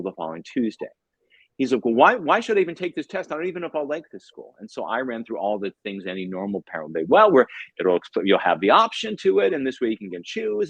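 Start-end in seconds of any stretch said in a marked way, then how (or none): 1.8-4.5 put-on high-pitched, indignant kid's objection; protesting kid. Retells kid resisting the test. 7.1-10.7 reasoning parent. Retold or imitated. Mimics reasoning of normal parent.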